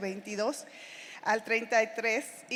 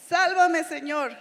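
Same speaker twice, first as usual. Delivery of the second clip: loud, high plea to be saved; cry for help; 5 semitones higher, 5 dB louder, slower than usual. A narrator's reading. Peter sinking in the waves calls to Jesus, loud plea. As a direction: call out much louder as if sinking. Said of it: "gritó".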